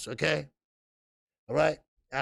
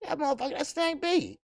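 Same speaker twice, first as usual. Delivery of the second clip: high, laughing mock amazement; retold amazed recognition; 11 semitones higher than usual. Recalling recognizing Snap B in the photo, high amused amazement. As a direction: squeal it like you just spotted him. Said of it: "And I said".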